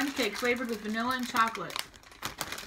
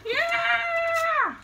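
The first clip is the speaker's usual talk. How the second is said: excited shout; 4 dB louder than usual. Real, not acted. Cheering at snack box.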